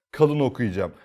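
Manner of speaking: ordinary delivery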